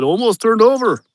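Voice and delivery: squeaky imitation of the worker's Mexican accent; mock-scared Mexican accent. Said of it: "He said".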